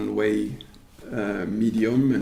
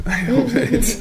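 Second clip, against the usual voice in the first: laughing; 13 semitones higher, 6 dB louder than usual. Real, not acted. Laughs at his own hedging.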